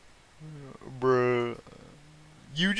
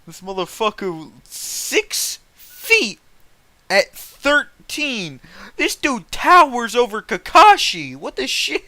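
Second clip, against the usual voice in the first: incredulous; 9 semitones higher, 9 dB louder than usual. Real, not acted. Streamer rereading the height to chat, higher in disbelief. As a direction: stretch it out, utterly baffled.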